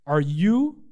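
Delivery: ordinary talk, nothing marked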